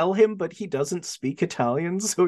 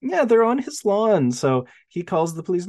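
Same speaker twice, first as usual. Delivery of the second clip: usual speaking voice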